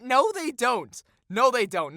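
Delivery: real heated denial; exasperated contradiction. Real, not acted. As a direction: shoot it down loudly, twice. Flat contradiction of the article.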